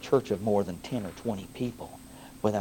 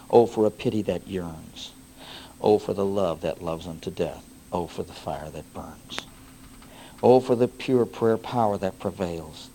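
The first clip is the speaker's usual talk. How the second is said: recited hymn poem; 3 semitones lower, 5 dB louder than usual. Acted.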